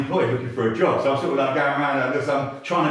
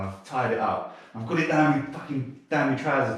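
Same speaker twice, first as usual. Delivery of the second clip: normal talking voice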